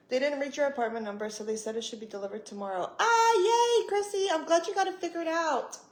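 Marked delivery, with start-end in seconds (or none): 3.0-5.8 high, excited squeal settling into congratulations; high-pitched happy burst; delighted cheer. Real, not acted. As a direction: cheer for a friend's good news. Congratulates a viewer on good news.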